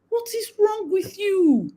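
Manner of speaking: high-pitched, drawn-out indignant demand; indignant scolding. Acted. Telling off a parent who pushes a child, indignant scolding. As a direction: scold slowly with the pitch right up.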